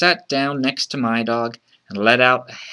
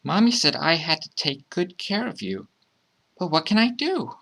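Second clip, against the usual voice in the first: put-on high little-kid voice, at a loss; child's voice; 5 semitones higher than usual. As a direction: worry aloud in a child's voice, climbing higher. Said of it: "the child thought".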